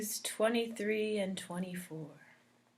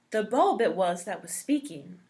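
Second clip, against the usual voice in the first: usual speaking voice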